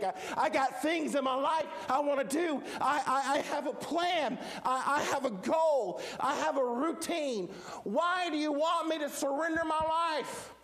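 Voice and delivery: high, stammering, pleading objection; worked-up protest. Acted. Believer refusing to surrender his life to God, worked-up protest. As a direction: build to an exasperated high-pitched question.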